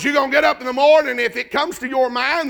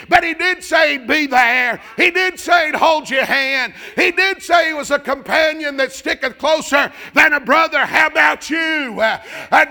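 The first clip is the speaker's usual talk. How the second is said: loud, higher rhythmic preacher's cry; full-force revival preaching; fiery preaching; 5 semitones higher, 5 dB louder, slower than usual. Acted. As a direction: drive it home to the congregation.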